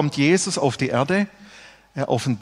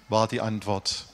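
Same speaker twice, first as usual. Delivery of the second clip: ordinary talk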